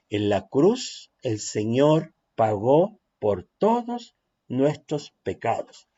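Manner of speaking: slow, measured phrase; slow delivery. Shown as a demonstration. Teacher demonstrating a slow sermon line. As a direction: let each word sink in. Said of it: "un poco más lento".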